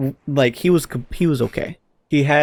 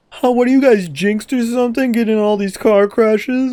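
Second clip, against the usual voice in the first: mocking girlfriend voice; 9 semitones higher than usual. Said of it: "and they're like".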